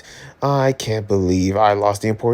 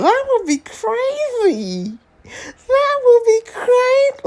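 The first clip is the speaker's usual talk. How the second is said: very high, drawn-out squeal; mock-panicked slow squeal; high-pitched freak-out; slower than usual. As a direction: stretch each word out in a squeaky, sky-high voice. Freaking out over the comic.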